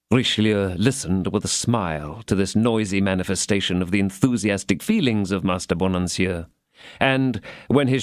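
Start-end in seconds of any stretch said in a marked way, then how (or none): none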